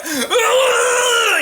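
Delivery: high strained angry grunt; angry frustrated grunt. Retold or imitated. Macho cop squaring up to a colleague, high angry grunt. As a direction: growl it out like a furious cop.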